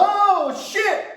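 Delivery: high yelp after stepping on a tack; yelp of pain. Retold or imitated. Kid steps on a tack, high pained yelp. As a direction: squeal the curse out high.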